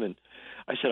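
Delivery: ordinary talk, nothing marked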